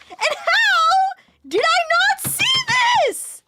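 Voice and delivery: high, loud, laughing disbelief; loud exasperated question; shouted disbelief. Real, not acted. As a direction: yell it in disbelief. Disbelief at own miss.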